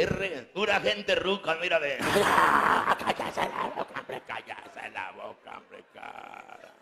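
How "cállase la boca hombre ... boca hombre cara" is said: slow fading dummy scold. Acted.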